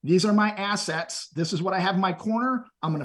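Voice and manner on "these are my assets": ordinary delivery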